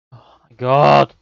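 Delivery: exasperated groan. Real, not acted. Conceding again, exasperated.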